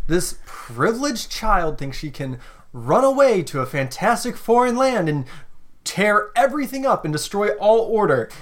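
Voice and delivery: high, projected theatrical denunciation; talking over someone. Pretend. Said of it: "she's just talking over you".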